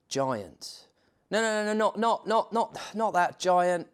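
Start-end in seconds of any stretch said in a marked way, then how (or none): 1.3-3.9 high, rushed no no no; flustered protest. Real, not acted. Narrator protesting to the video editor, flustered and high. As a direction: stop the wrong picture in alarm.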